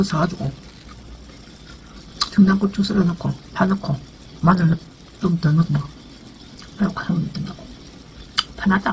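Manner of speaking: speaking between bites; talking while chewing. Real, not acted. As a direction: mouth full of food. Seen 3: Puffed cheeks and pursed chewing lips throughout; takes another bite at 5.0.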